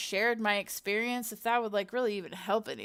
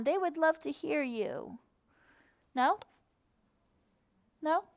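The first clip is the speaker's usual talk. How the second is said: much higher, coaxing pet talk; talking to the cat; 7 semitones higher, 4 dB louder than usual. Real, not acted. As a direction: sweet-talk the cat into saying hi. Coaxing cat to come and meow.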